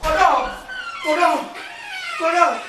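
loud repeated call of the name; high, loud call for someone. Acted. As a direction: shout the name across the house. Calling out a name loudly.

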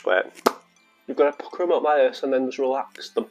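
normal talking voice

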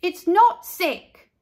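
high, loud, slow protest on not; indignant shout. A narrator's reading. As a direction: shout back defending it. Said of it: "said Carla".

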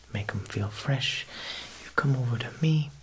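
ordinary talk

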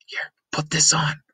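put-on urgent girl whisper. Sneaking, girl whispers instructions.

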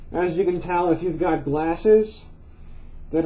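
muffled behind face mask; speaking inside half-face respirator; through a respirator mask. Real, not acted. Seen 1: Respirator covers nose and mouth in every labelled frame.

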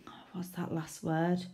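ordinary delivery

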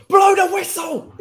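high, loud cry at the ref; screaming at the ref to end it; desperate shout. Real, not acted. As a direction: yell it, begging for the final whistle. Desperate at ref, late game.

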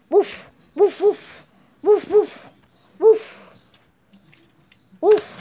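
high pretend dog woofs; dog barking. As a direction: bark like a dog in short bursts. Dog character barks at chicken.

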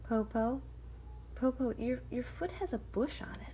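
put-on puzzled child asking granny; curious little girl. Shang asking grandmother about her foot, puzzled.

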